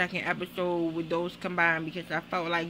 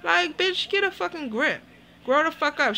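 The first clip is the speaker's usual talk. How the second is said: higher and louder, telling her off; heated scolding; 8 semitones higher, 3 dB louder than usual. Real, not acted. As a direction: snap at her to grow up. Scolding the cast member.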